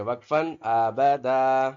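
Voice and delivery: आबदा said slow and loud with a long open final दा; pausal reading. Shown as a demonstration. Teacher demonstrates stopping at the verse break. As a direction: stretch out आबदा, no nasal ending. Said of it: "तो इसको वक्फन बोलेंगे".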